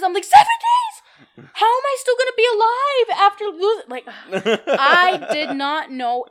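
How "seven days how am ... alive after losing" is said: squeaky high, loud disbelief, laughing through it; shocked kid. Retold or imitated. Kid hearing period lasts seven days, shrieking in disbelief.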